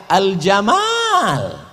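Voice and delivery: "Al-Jamal" with the alif stretched very slow and called out; long alif. Shown as a demonstration. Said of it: "itu pakai alif".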